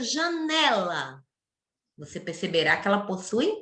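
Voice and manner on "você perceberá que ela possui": ordinary talk, nothing marked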